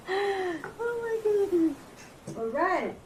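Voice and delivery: high, slow teary sigh of awe; overcome, half crying. Real, not acted. Vlogger overwhelmed by her own book, teary.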